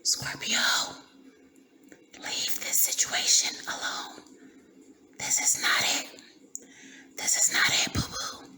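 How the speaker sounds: airy whisper in the ear, hushed and close. Pretend. Spirit team whispering a warning to Scorpio, hushed whisper. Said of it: "that whisper in your ear".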